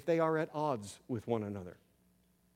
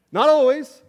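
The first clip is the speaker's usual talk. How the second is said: laughing aside; 11 semitones higher, 15 dB louder than usual. Real, not acted. Laughing at mom's remark.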